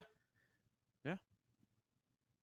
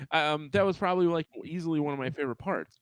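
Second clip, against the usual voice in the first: ordinary talk, nothing marked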